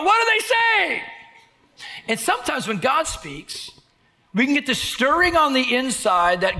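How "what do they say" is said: put-on high, slow puzzled kid; childlike high voice. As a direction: ask like a confused child. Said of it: "as a kid, I go".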